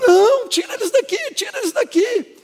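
high, loud panicked pleading; imitated terrified scream. Preacher re-enacting the girl begging to be rid of them, panicked high scream. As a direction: shriek like her, begging for rescue. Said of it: "Essa menina gritava, ela gritava".

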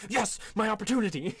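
high, loud triumphant cry; gleeful triumph. Acted. Bard seeing the pants fall, high gleeful triumph. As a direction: exult as the chance opens up. Character seizes his chance gleefully.

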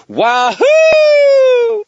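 very high, long fairy whoop; shouted whoop. A narrator's reading. Fairy hears of pizza pay, shouted high whoop. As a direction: squeal it out full blast, held long. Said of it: "Toot shouted and buzzed".

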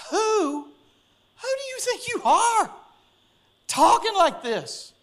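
high-pitched, drawn-out mock outrage; indignant Pharisees. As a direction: stretch it out high, scandalized at the nerve. Pharisees voiced challenging Jesus, mock outrage.

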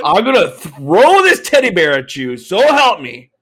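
mock threat.

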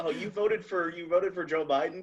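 high, soft, mock-friendly question; cutesy app voice. Twitter sweetly checking a user's vote, mock friendly. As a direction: coo it softly, pitched well up. Playing Twitter greeting a voter.

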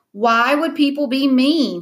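high, drawn-out sarcastic question. A narrator's reading. Brother to sister after a silly question, sarcastic. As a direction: ask it as if it's the dumbest question. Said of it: "heard him be that sarcastic".

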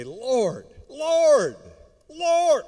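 rising from plain to loud, high call; building cry. Acted. Preacher builds Lord, Lord cry.

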